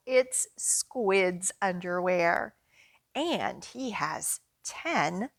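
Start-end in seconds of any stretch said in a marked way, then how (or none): none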